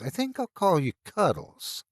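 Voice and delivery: high, sing-song mockery; sneering baby talk to a monster; mocking. A narrator's reading. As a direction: tease it like a child's pet. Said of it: "I said in a mocking voice".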